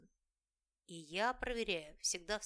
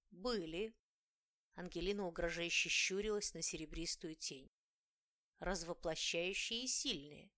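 ordinary delivery, nothing marked

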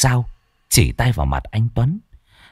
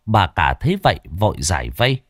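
usual speaking voice

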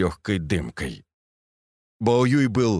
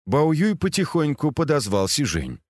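ordinary delivery

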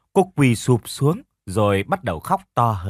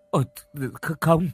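tearful pleading; 3 semitones higher than usual. A narrator's reading. Niece begs not to abort.

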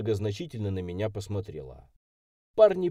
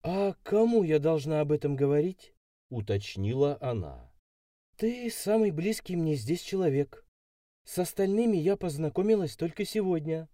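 put-on higher-pitched voice for an ingenuous girl; naive city girl, earnest; 6 semitones higher than usual. A narrator's reading. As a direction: explain innocently as the city girl. Narrator voices young blonde Alisa.

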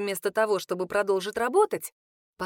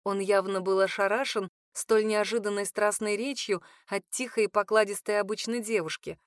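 plain reading voice

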